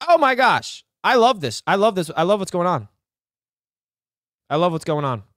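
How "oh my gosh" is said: ordinary delivery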